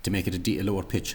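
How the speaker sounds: ordinary talk, nothing marked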